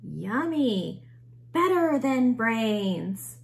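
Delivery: loud, slow delighted zombies; happy zombie cheer. A narrator's reading.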